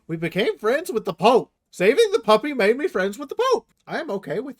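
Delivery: loud, high indignant vow; rally the troops for the puppy; shouted outrage, rising to glee; fierce shout. Pretend.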